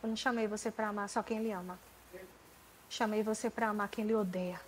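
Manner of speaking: soft, hushed and tender; God's gentle voice. Retold or imitated. God speaking to her heart in the nursery, soft and tender. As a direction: speak it as a gentle rebuke of love. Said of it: "E ele disse".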